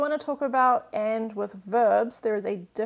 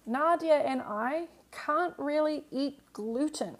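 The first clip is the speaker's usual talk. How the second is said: slow, careful sentence read for students; slow reading aloud; 3 semitones higher, slower than usual. Shown as a demonstration. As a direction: read it out slowly so they can follow. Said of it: "All right, this one".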